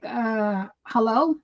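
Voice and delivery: mildly snotty questioning hello, rising at the end. Retold or imitated. Wife checking whether her distracted husband is listening, mildly snotty. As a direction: let a little impatience into a checking hello. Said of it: "I say it snotty sometimes".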